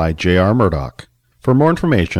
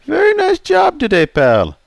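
put-on raised, jovial old customer; 4 dB louder than usual. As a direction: play Charlie, teasing. Charlie praises.

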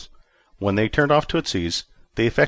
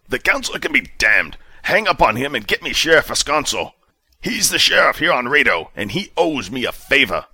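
a hint of a stern sheriff, higher and louder; clipped commanding officer tone; hint of a stern commander demanding answers; commander Justice, lightly voiced; 6 semitones higher, 5 dB louder than usual. A narrator's reading. Commander orders.

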